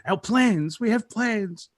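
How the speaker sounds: high, fast-starting mock protest; mock indignant complaint. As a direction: play the outraged other side, whining in protest. Voicing the upset other side.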